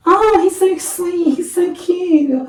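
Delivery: very high, drawn-out gush; exaggerated polite coo over someone's baby; mock baby-cooing. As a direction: squeal sweetly and slowly, sending up the admirers. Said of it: "everybody goes".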